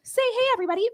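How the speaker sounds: high sing-song call to the crowd; cheerful presenter cue; host cue voice. Pretend. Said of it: "And then I'm gonna be like".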